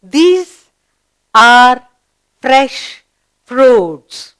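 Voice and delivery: word by word, loud, with pauses between; slow, emphatic classroom reading; read-along model. Shown as a demonstration. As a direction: read out each word separately for learners to follow. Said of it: "Let's do it together".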